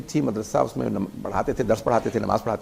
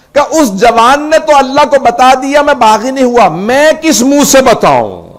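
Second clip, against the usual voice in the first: loud, higher impassioned cry, slowing into anguish; preaching at full force; 9 semitones higher, 9 dB louder, slower than usual. Acted. Preacher voicing the weeping scholar to mourners, impassioned cry. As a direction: lament it to the crowd, voice pushed and aching.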